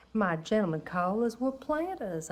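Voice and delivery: normal talking voice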